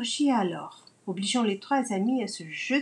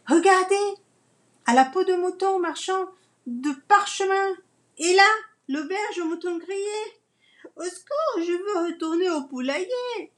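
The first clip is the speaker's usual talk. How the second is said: high, loud, wide-eyed excitement; excited high voice; 8 semitones higher, 4 dB louder than usual. Acted. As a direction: squeal it out in amazement. Amazed then panicked in the city.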